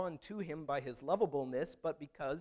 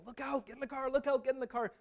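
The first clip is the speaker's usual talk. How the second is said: much higher urgent warning cry; sister yelling; 11 semitones higher, 3 dB louder than usual. Retold or imitated. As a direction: warn him to get back in the car now. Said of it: "my sister was yelling".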